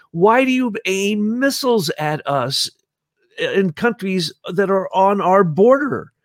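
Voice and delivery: imitating Putin. Imitating Putin asking America why NATO in a high pleading voice. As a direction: whine the whys like an aggrieved Putin. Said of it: "and he goes".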